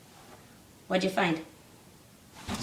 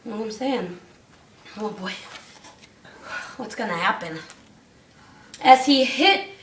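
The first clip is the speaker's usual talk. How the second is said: plain reading voice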